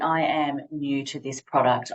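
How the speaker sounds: usual speaking voice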